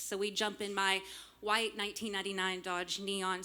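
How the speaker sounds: ordinary talk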